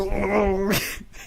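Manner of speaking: high burst of laughter; cracking up at yourself. Real, not acted. Laughs at own story.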